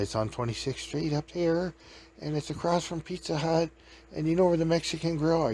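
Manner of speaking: lightly voiced nervous librarian still rattled; shaky librarian. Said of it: "still a little bit shaky from that Okie".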